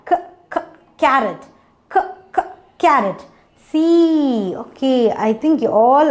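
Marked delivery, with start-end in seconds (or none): 0.1-3.2 "K, k" as short separate kuh bursts, then "carrot"; sounding out. Shown as a demonstration. Teacher shows what carrot begins with, sounding out. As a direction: tap out the first sound twice before "carrot". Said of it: "it begins with which sound?".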